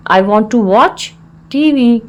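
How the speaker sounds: measured, with a clear mid-phrase pause; broken into parts. Shown as a demonstration. Teacher repeats the phrase for learners, in separated parts.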